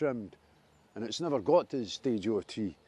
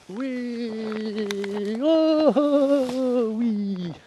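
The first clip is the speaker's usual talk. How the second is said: high, loud whoop of delight; gleeful squeal; 11 semitones higher, 8 dB louder, much slower than usual. Real, not acted. Pushing through wet bushes on the walk, squealing with glee.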